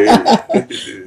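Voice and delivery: short airless laugh; breathy laugh. Real, not acted. Guest laughing at her own wish to be a twin. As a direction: let out a quick laugh.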